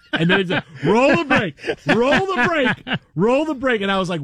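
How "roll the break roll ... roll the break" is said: urgent high call. Retold or imitated. Control room panicking to roll break.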